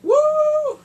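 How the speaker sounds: very high, loud "woo"; excited whoop. Real, not acted. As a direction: squeal a whoop at the steamy scene. Whoop over the love scene.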